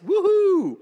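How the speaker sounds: high, loud woohoo; excited whoop. Real, not acted. Preacher cheering about his new Audi, high whoop.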